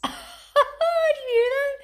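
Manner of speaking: laughing in disbelief, slow and loud. Real, not acted. Son teases about haircut.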